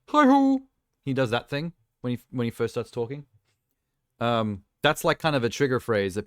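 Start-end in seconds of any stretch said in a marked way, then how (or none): 0.1-0.6 "Hi-ho!" yelped much higher and louder like Kermit the Frog; a squeaky puppet-frog "Hi-ho!" greeting. Shown as a demonstration. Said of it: "it's like".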